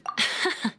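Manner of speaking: brief, breath-only laugh; little voiceless chuckle; short chuckle. Acted. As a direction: let out a small puff of laughter. Amused reaction in acted dialogue.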